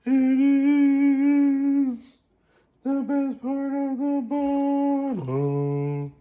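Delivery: very high, slow put-on voice; Buffalo Bill voice. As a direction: stretch every word out high and creepy. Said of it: "The Buffalo Bill voice".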